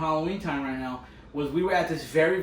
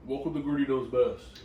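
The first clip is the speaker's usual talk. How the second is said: forcing a deep voice. Pretend. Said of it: "You literally just did a fake deep voice".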